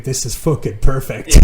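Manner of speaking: loud, half-laughing retold thought; laughing delight. Friend recalls first seeing the shabby room, laughing delight. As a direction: recall your delight loudly through a laugh.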